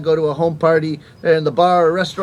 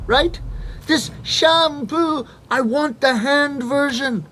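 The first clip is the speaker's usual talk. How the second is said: hammed-up desperate customer; mimed frantic customer; 6 semitones higher than usual.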